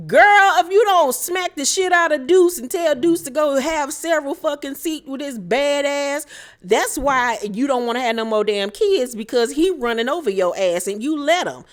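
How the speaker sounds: loud, high rant; exasperated scolding. Real, not acted. Host ranting at Sonya about her son, loud and high. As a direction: tell her off, fed up.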